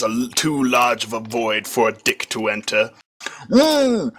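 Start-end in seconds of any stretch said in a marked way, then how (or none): 3.5-4.1 high choked gagging mm; a short stifled gag sound; muffled gag. A narrator's reading. Lulu chokes while held down, a high muffled gag. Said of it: "Lulu began to cough".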